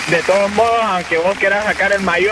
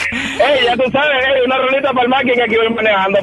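reggaeton persona; 5 semitones higher than usual. Acted. Caller acts a reggaeton driver.

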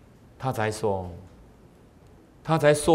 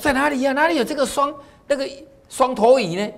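high-pitched eager merchant; 12 semitones higher than usual. Acted. Merchant asks about two-headed fish.

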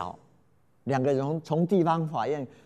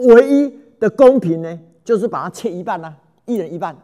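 king's stern verdict; 2 semitones higher, 9 dB louder than usual. Acted. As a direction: pronounce the verdict with the sword raised. King raises sword, declares split.

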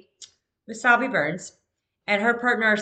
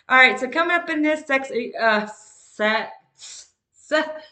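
tongue sticking from a dry mouth; words catching on a dry tongue; 3 semitones higher, 6 dB louder than usual. Real, not acted. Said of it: "My mouth is dry right now".

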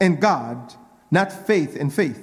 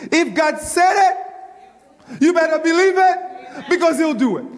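shouted high at full force; preaching shout; 7 semitones higher than usual. Real, not acted. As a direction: shout it out to the congregation. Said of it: "I feel like preaching now".

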